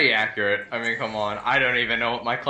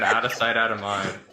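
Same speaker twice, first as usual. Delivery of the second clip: ordinary delivery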